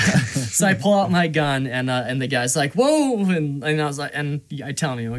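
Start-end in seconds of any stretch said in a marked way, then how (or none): 2.7-3.2 high, loud mock whoa; startled. Gunsmith facing a drawn gun, startled. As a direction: exclaim it as if caught off guard.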